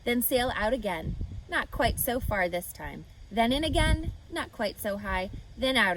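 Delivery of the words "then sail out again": usual speaking voice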